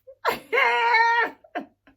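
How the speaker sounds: loud high laughing fit; loud laughter. Real, not acted. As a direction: burst out cackling. Laughing at the memory.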